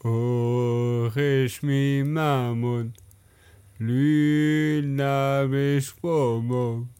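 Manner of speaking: "Aurais-je mis ma montre" slowly attempted in reverse; halting slow reversed song by ear; backwards speech. Shown as a demonstration. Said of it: "à toi, vas-y".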